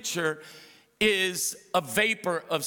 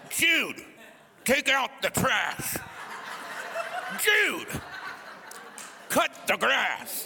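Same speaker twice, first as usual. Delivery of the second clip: mom with cigarette; 9 semitones higher, slower than usual. Retold or imitated. Chain-smoking mom calling her son to chores, high shout. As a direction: yell chores round a cigarette. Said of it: "cigarette in her mouth".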